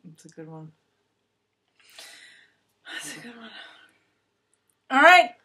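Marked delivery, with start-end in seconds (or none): 0.0-0.7 hushed, low murmur under her breath; quiet aside. Real, not acted. Host murmuring her agreement to the guest, quietly. 2.9-3.5 breathy whispered echo. Real, not acted. Host whispering her agreement back to herself.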